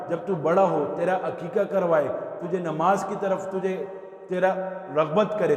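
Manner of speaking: fiery sermon cadence; preaching at full force. Acted. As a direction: preach the father's duties to the crowd. Quoting Umar's list of duties.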